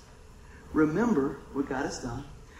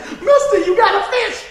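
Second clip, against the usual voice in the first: slow laughing yell, much higher and louder; calling out; 13 semitones higher, 11 dB louder than usual. Retold or imitated. Boy shouts to preacher that his pole has a fish, laughing. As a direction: holler across the pier with delight.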